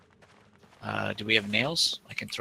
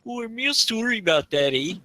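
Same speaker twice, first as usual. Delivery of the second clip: much higher, sing-song East Coast Canadian "real sorry, eh"; East Coast Canadian accent; 10 semitones higher than usual. Retold or imitated. Canadian player obliging his friend's request, thick East Coast Canadian accent. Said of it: "East Coast Canadian accent".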